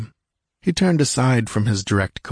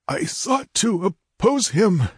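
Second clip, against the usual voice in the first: high, gurgling dying voice; 11 semitones higher, slower than usual. Acted. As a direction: gurgle through a throat full of blood. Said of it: "gurgled Tecuhltli".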